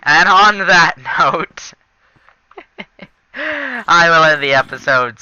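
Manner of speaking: loud, high, drawn-out announcement; mock-dramatic sign-off. Player ending the episode after dying, mock-dramatic sign-off. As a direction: declare it slowly and grandly, half laughing.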